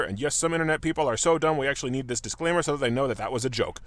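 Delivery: usual speaking voice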